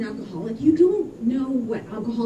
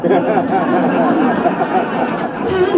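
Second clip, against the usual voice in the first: big laugh; 8 semitones lower, 5 dB louder than usual. Real, not acted. Laughing at the punchline.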